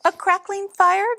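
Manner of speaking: high and tentative, testing the waters; high, hopeful little mouse asking; timid high mouse. A narrator's reading. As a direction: venture it in a high small voice. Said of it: "ventured Mouse".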